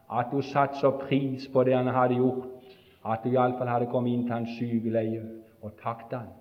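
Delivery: normal talking voice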